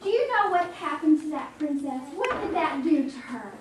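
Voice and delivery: ordinary delivery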